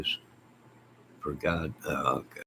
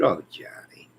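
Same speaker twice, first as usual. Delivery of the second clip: breathy whispered quote. Retold or imitated. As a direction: whisper it like a secret. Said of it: "she posted".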